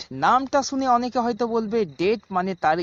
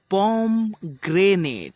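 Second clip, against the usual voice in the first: slow demonstration; much slower than usual. Shown as a demonstration. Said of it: "slow model word for learners".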